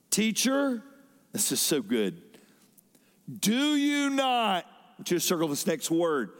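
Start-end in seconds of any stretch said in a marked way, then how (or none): 0.1-0.8 high, urgent call of Teacher; desperate cry. Retold or imitated. Frightened disciples calling to their sleeping teacher, high and urgent. 3.4-4.7 pleading cry. Retold or imitated. Frightened disciples pleading with Jesus, high and drawn out.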